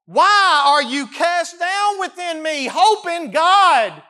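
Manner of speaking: very high, slow, loud cry; desperate plea to his soul. Acted. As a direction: stretch it into an anguished cry. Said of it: "he cries out to him".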